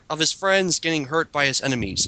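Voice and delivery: usual speaking voice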